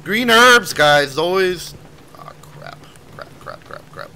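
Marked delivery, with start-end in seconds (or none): 2.2-4.1 breathy whispered curse. Real, not acted. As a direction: whisper it in dismay. Whispered dismay in game.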